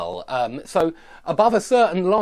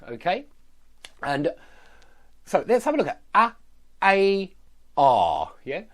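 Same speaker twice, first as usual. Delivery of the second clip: usual speaking voice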